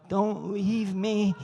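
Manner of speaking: slow, drawn-out mock plea; hammed-up pitiful pet voice; pleading dog. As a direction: beg like a dog left in its cage.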